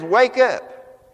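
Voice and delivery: sharp loud call to wake; commanding call. Acted. Jesus calling the dead church awake. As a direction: bark it out like a rousing order. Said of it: "He says".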